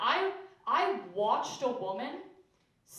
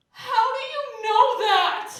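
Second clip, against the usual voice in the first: high, loud, slow comic gasp; baffled friend; 12 semitones higher, 11 dB louder, slower than usual. Retold or imitated. Comic mocking a brunch ad friend, high loud squeal. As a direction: squeal it in mock amazement. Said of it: "she just goes".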